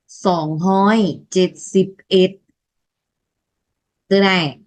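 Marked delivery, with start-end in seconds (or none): 0.1-2.4 "sòng hoi chết xịp ết" spelled out slowly, each syllable clear; slow Lao numeral demo. Shown as a demonstration. Teacher modeling "sòng hoi chết xịp ết" for students, slow and clear. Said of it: "mình đọc là".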